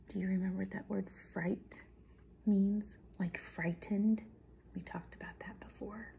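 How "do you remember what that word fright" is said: ordinary delivery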